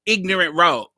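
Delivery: ordinary talk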